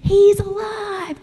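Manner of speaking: high, drawn-out joyful proclamation; best Easter voice. Shown as a demonstration. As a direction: model a jubilant Easter cry for children. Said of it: "in your best Easter voice".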